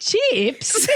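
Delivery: put-on very high child echoing Australian "chips"; baffled child copying an Australian "chips"; incredulous accent mimicry. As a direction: squeak "chips" back, mocking the Australian accent. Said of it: "And he goes".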